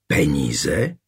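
low-pitched, puzzled echo with a rising end; uncomprehending question. A narrator's reading. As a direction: ask it back, not understanding at all. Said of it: "opáčila nechápavě".

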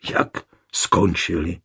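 a mild, blurted, uncomprehending question; blurted in disbelief. A narrator's reading. Wife to husband, a mild disbelieving blurt. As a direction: gasp it out as if she can't believe it. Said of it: "Vyhrkla nechápavě".

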